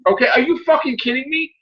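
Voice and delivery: normal talking voice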